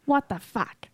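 imitating her Jersey swear; mock her East Coast what the fuck; a hint of angry Jersey driver; Jersey what the fuck impression. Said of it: "the way you say it".

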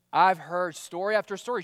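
usual speaking voice

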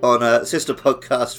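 normal talking voice

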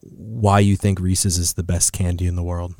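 ordinary talk

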